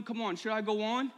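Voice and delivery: ordinary talk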